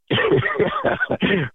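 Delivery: amused breathy laugh at himself. Real, not acted. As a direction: laugh off the forgotten lyrics. Laughs after forgetting the lyrics.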